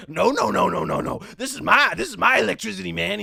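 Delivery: imitating an agitated kid, loud and swooping high; crazy kid's voice. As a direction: protest loudly in a put-on oddball voice. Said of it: "I swear this is his voice".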